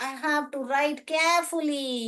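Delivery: slow, deliberate words; telling herself to write carefully, slowly. Shown as a demonstration. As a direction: spell each word out with care. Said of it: "मैं अपने आप को बोलती हूँ".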